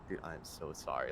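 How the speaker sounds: low, hushed retold apology; sheepish apology. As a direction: say sorry quietly, mortified. Retelling his apology to injured friend.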